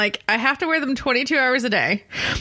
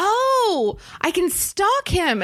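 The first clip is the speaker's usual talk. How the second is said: sudden realization; 9 semitones higher, much slower than usual. Retold or imitated. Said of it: "she goes".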